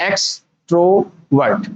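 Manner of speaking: एक्सट्रोवर्ट slowly as ex, tro, vert; divided into parts. Shown as a demonstration. Teacher repeating the division for students, एक्सट्रोवर्ट slowly in parts. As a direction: stretch एक्सट्रोवर्ट into three separate pieces. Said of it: "parts में divide करके पढ़ोगे".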